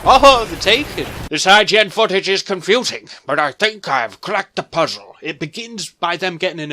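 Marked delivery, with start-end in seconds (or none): 0.0-1.1 excited surprise. Real, not acted. Recognizes the enemy in footage.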